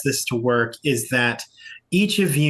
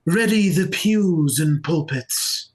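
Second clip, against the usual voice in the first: raised pitch, stately pauses between phrases; church leader proclaiming; 4 semitones higher than usual. Acted. Church leader calling members to a diplomatic meeting, ceremonious. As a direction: proclaim it formally to a congregation.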